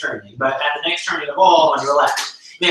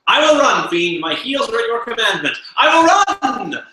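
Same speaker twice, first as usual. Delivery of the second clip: higher, louder gleeful cry with a laugh; excited resolve; 8 semitones higher, 4 dB louder than usual. Acted. Lancelot tells the fiend he will run, gleeful shout. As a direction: burst out with the decision, laughing.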